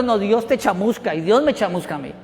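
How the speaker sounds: normal talking voice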